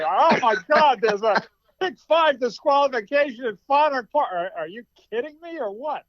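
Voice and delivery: mock outrage. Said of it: "what the hell are these people talking".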